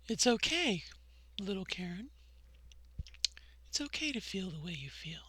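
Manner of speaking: put-on soft and low, easy, like comforting a small child; gentle reassurance. Reassuring five-year-old little Karen, softly and tenderly. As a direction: hush it down tenderly to a little girl.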